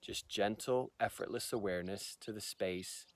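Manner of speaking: soft, unhurried meditation voice with long pauses; guided meditation. Shown as a demonstration. Teacher guiding viewers through the microcosmic orbit practice, calm meditation voice. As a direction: ease them gently into stillness, leaving space between phrases.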